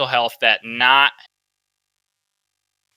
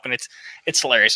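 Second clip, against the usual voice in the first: normal talking voice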